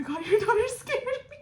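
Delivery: high, loud laughing words. Real, not acted. Teasing her friend that the daughter scared her, laughing loudly.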